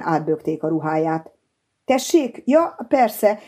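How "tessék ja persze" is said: higher, startled and embarrassed; embarrassed boy caught staring; flustered. A narrator's reading. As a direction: snap out of a daydream, flustered. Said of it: "felelte zavartan".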